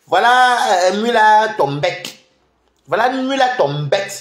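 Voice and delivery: slow taunting call, voice up. Real, not acted. Livestreamer taunting a rival, calling her name out slowly.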